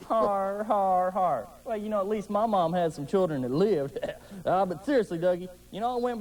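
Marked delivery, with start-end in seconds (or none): 0.1-1.5 dry, sarcastic har har har; mock laugh at the joke; sarcastic fake laugh.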